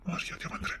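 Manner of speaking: whispered threat, barely voiced; husband whispering a threat to the wife he has locked in; threatening whisper. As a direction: hiss the warning under your breath. Husband threatening the trapped woman.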